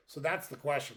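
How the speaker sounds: ordinary talk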